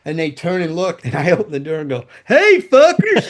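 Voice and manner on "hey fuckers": loud, high, drawn-out yell of hey fuckers; cocky rock-and-roll entrance holler; brash greeting. Retold or imitated. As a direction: burst in and holler it at the band. Bursting into rehearsal room greeting.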